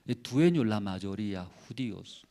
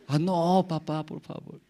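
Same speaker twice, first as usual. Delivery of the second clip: lightly voiced higher begging child; pleading child; 6 semitones higher than usual. Son begging father not to whip him, mild pleading. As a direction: plead with father, a hint of a small boy. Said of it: "child begs father".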